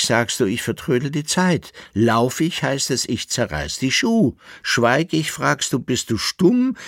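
high, bouncing litany of complaints; rhymed singsong. A narrator's reading. Koppelbär lists his companion's contradictions, in rhyming singsong. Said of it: "seinen gereimten Singsang".

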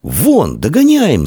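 high, excited chase call; narrated boy's shout; shouted urging. A narrator's reading. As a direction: cry out, rising in pitch. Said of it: "закричал Амвросий".

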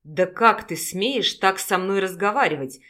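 usual speaking voice

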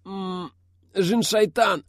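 high, strained protest broken by a grunt; pained groaning. A narrator's reading. Wounded Aidar warns of roadblocks, high groaning. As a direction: moan and curse through the pain. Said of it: "застонал Айдар, кривя лицо".

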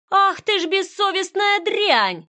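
high, slow, shrill-edged howl of outrage; furious wail. Acted. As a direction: scream it at her like a woman beside herself. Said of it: "заголосила она". (яростно заголосила)

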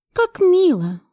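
high-pitched mock admiration; feigned delight. A narrator's reading. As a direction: gush insincerely. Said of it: "делано восхитилась я". (деланое восхищение)

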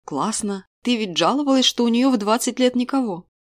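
usual speaking voice

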